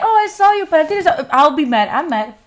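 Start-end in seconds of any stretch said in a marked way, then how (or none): 0.0-1.3 mock casual excuse. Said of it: "you say that".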